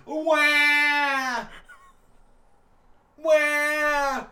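put-on loud high baby wail; baby cry. Teacher acting a crying baby.

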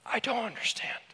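"I don't understand" whispered, breathy and hushed. Real, not acted. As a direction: breathe the words out, no voice. Preacher admits confusion in whisper.

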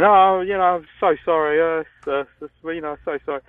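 high, flustered imitation of dad; apologetic dad. Dad to [name] after the dog's accident, flustered apology. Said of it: "my dad's saying".

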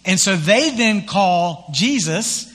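ordinary talk, nothing marked

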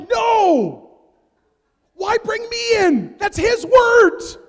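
high, loud, slow protesting shout; alarmed protest. Retold or imitated. Pastor refusing credit for Sean's prophecy, high slow shout.

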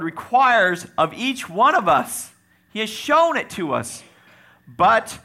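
loud, high, emphatic sermon delivery; impassioned preacher declaring scripture; full-force preaching. Acted. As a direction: proclaim it to the congregation with rising power.

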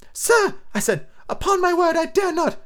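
put-on high, frightened boy; young boy voice. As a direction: plead nervously like a lad.

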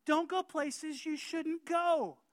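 full-force pulpit preaching. Acted. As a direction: drive the warning home from the pulpit.

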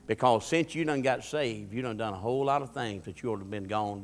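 ordinary talk, nothing marked

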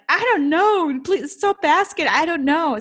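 exasperated outburst. Retold or imitated. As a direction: act out pleading to be left alone. Acted upset reply to mother's questions.

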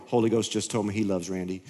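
low, soft, sugary; a hushed, saintly murmur; mock sweet church whisper. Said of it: "they were probably going".

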